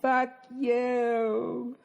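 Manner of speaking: high, drawn-out mock heckle; mock heckling woman. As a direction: mimic a woman yelling in a thin falsetto. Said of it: "yelling. She's like".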